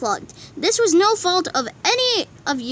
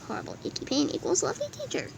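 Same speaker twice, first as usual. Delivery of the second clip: fast, much softer eerie aside; creepy whisper; 11 dB quieter, much faster than usual. Acted. Dark joke about conversion.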